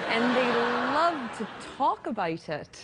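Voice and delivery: put-on slow, drawn-out posh Glasgow accent; posh Scottish accent. Guest mimicking Newton Mearns residents, posh Scottish accent. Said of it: "do you do a good Scottish accent".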